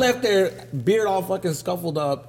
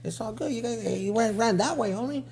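higher, softened voice; calm cover story; 5 semitones higher, 6 dB quieter than usual. Retold or imitated. Barber covering for a hiding man, softly casual.

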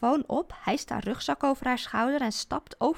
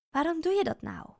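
indignant; 7 semitones higher than usual. Acted. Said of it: "vraag ik verontwaardigd".

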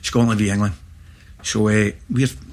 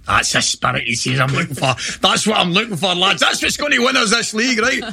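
gruff manager's praise; 7 semitones higher than usual. Retold or imitated. Manager Souness voiced praising the fight.

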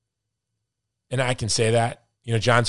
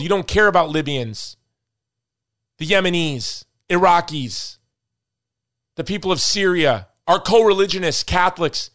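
raised, louder, higher-pitched tirade; heated rant; 6 semitones higher, 4 dB louder than usual. Real, not acted. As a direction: lecture them hard, voice up. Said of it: "I'm sorry I'm ranting".

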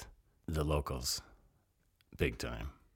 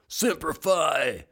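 slow, much higher, drawn-out Semper Fi; Marine greeting; 11 semitones higher, 4 dB louder, much slower than usual. Retold or imitated. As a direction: call it out slow and high like a fellow Marine. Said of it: "the first thing he says is he goes".

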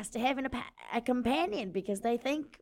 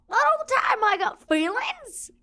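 high, then slow hurt plea; higher hurt protest, slowing; wounded protest; 7 semitones higher than usual. Acted. Tough guy admits feelings.

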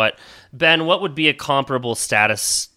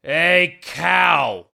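heckling call; 4 semitones higher than usual. Acted. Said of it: "a voice in the background go".

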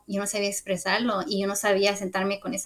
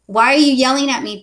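imitating a daughter's firm protest, higher and louder; retold boundary-setting; 4 semitones higher, 7 dB louder than usual. Daughter objects to mother yelling at her, retold firm protest. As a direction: push back on being yelled at. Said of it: "holds her boundaries".

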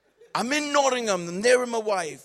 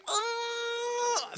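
stalling uh; 3 dB quieter than usual. Retold or imitated. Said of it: "I was like".

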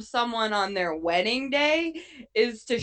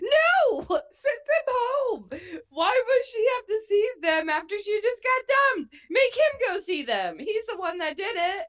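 outraged yell; 10 semitones higher than usual. Retold or imitated. Friend retelling yelling at the screen, high mock-outraged protest.

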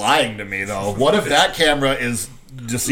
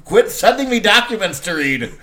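baffled quarterback; 6 semitones higher, 3 dB louder than usual. Retold or imitated. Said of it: "He's like".

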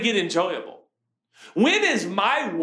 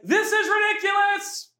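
retold yell; 13 semitones higher than usual. Dad yelling to his wife on the trail that he hates this. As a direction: whine it out like a worn-out kid at the top of your lungs. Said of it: "And I'm yelling".

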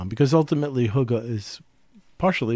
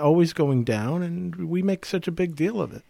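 higher-pitched, airy impression of someone; bad imitation of Brenda; 6 semitones higher than usual. Asked what a colleague would say about time, bad higher-pitched imitation. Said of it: "my bad imitation of Brenda".

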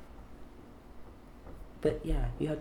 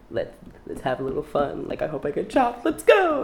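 excited; 5 semitones higher, 7 dB louder than usual. Retold or imitated. Hyped-up self-talk before battle.

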